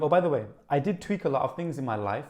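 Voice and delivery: usual speaking voice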